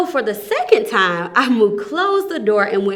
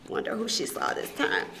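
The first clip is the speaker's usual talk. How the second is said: whispered aside; 11 dB quieter, faster than usual. Real, not acted. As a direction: whisper to the listeners. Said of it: "storyteller aside".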